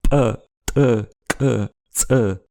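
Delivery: P popped with a closed glottis; P and each following "e" as separate ejectives; ejective consonants. Shown as a demonstration. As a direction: build mouth pressure, then release P sharply. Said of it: "on a les éjectives".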